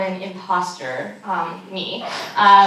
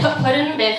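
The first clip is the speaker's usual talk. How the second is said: flustered compliance; 2 semitones higher, much faster than usual. Retold or imitated. Teen traveler following the agent's order, flustered echo. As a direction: repeat it while scrambling to obey.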